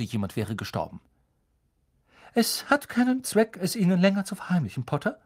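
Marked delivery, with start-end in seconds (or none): 2.3-5.2 very serious and high-pitched, fast; grave, serious tone. A narrator's reading. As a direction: break hard news gravely. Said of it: "sagte sie in sehr ernstem Ton".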